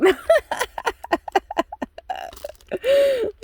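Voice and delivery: a burst of high, self-mocking laughter; squealing giggle. Real, not acted. Laughing at her own joke.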